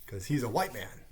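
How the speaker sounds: ordinary talk, nothing marked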